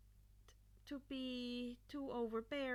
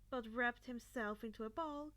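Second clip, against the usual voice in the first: ordinary delivery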